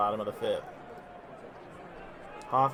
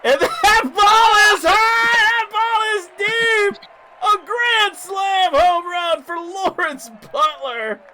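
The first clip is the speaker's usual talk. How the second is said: much higher, louder, slow shouted call; home run call; 12 dB louder, slower than usual. Acted. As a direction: belt it out like a stadium announcer. Grand slam home run call.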